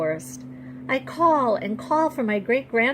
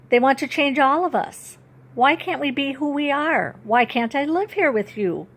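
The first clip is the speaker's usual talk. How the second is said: pleading; 6 semitones higher, 4 dB louder than usual. Acted. Girl pleading with great-grandfather.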